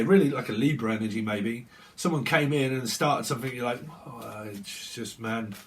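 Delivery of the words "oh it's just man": slow, low and soft sigh of words; weary exasperation. Acted. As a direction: sound fed up, dragging it out quietly. Exasperated reaction to time waster.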